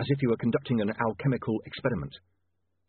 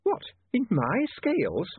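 high, slow, puzzled question; perplexed landlady; 10 semitones higher, slower than usual. A narrator's reading. Landlady hearing her scales matter to the city, perplexed. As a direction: draw it out in bewilderment.

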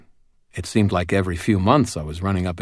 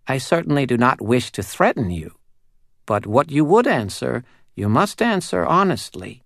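high, tiny, eerie doll voice; a small uncanny doll spirit speaking; 6 semitones higher than usual. Acted. Doll explains terms.

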